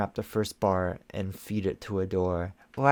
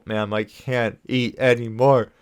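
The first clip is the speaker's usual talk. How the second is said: pleading; 2 semitones higher, 10 dB louder, slower than usual. Acted. Adora pleading, full.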